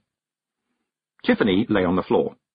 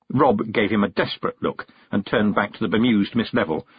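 plain reading voice

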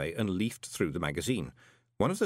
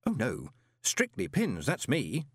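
hasty; 6 semitones higher than usual. A narrator's reading. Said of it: "said Moist hastily".

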